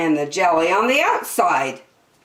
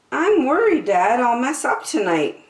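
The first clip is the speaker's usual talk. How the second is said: put-on worried girl voice; 7 semitones higher, 4 dB louder than usual. Worried girl speaks to father.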